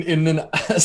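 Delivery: ordinary delivery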